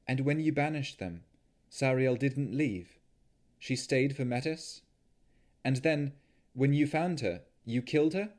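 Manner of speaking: Navara questioning. Acted. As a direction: press him gently with each question. Navara questions Strahd, pressing.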